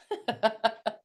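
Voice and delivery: light laugh. Real, not acted. Laughing at seeing Pam.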